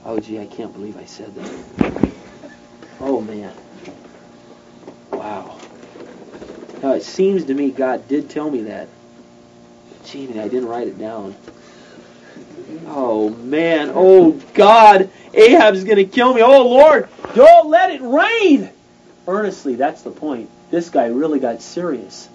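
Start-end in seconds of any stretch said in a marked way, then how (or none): 0.1-13.9 lower, softer worried muttering; quiet dismay. Acted. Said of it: "he walks away and he goes". 13.9-18.7 much higher and louder, slow desperate begging; panicked pleading. Acted. Elijah panics, begging God.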